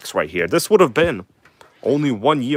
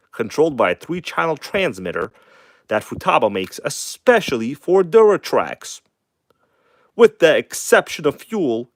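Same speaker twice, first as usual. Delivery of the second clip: voiceover read; 3 semitones higher, 3 dB louder than usual. Acted. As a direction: ham it up like a paid voiceover. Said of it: "do some voiceovers for your videos".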